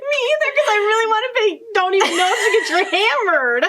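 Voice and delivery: high voice breaking into laughter; helpless laughter. Real, not acted. Host choosing the hammered answer, laughing high. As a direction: squeeze the words out through giggles. Cracking up over quiz answer.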